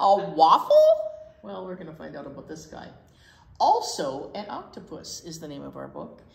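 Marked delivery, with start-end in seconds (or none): 0.0-0.8 laughing question. Real, not acted.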